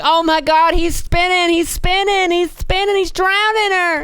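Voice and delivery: high squealing mock old lady; hysterical old lady. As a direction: shriek it like a panicked old woman. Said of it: "She's like".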